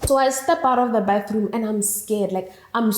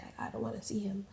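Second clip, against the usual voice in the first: much softer, fast, barely there; hushed refusal; 16 dB quieter, faster than usual. Retold or imitated. Scared of the man at the table, barely audible. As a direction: say it almost under your breath.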